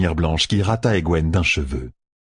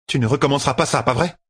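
high-pitched curt reproach from the sister; dry, curt; 7 semitones higher, faster than usual. A narrator's reading. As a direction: demand a promise drily, voice pitched up. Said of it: "dit-elle sèchement".

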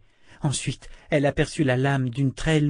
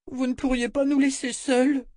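put-on very high, pleading child voice; timid little girl; 13 semitones higher than usual. A narrator's reading. Girl asking the adults to leave her alone, timidly pleading. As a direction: ask timidly in a much higher child's voice. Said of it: "souffla Rachel".

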